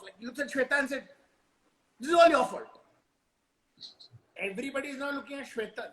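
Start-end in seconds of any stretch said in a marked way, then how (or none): 2.0-2.8 loud, high accusing outburst; pissed off. Acted. Embarrassed man blaming Shweta for it, pissed off. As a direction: snap the blame at her, acted. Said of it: "pissed off".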